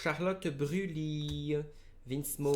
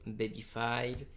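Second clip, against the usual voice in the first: ordinary talk, nothing marked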